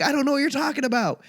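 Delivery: high, quick put-on voice; mock baffled staffer. Mall staff answering a go-kart seeker, mock bafflement. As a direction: brush off the question in a squeaky mock voice.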